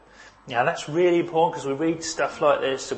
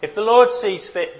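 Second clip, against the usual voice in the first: "Lord" lifted high and louder; quoting David, lifted; 7 semitones higher, 4 dB louder than usual. Retold or imitated. A preacher voices David on "Lord", higher and louder. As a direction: raise "Lord" as David resigns himself. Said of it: "David says".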